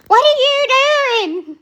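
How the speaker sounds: high, slow mock yell of "What are you doing?"; imitated scream at a learner. Teacher screaming at a learner driver, mock yell. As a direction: shriek it like a panicked driving teacher. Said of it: "to scream at 'em".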